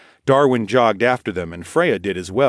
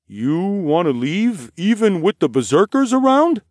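high, incredulous guard's question; doubtful; 10 semitones higher, 4 dB louder than usual. A narrator's reading. Guard questions travelers leaving with berserkers around, doubtfully. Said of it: "looked doubtfully".

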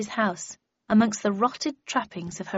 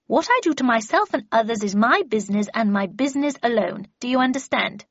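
imperious Miss Havisham; 3 semitones higher, 5 dB louder than usual. Acted. Miss Havisham dictates rules to her apprentice, imperious. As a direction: lay down rules that allow no argument.

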